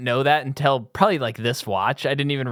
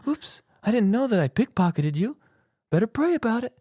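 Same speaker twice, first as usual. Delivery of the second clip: much higher, put-on naive voice; Mark Wahlberg impression; 9 semitones higher than usual. Host mocks Wahlberg as a pious bumbling thief, in a high silly voice. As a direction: shrug off a pickpocketing with innocent piety. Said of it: "He'd be all like".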